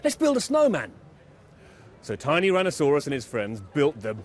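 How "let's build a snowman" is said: Tiny's voice. A narrator's reading. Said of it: "said Tiny".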